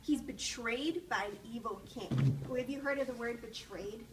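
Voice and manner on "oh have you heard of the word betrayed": ordinary delivery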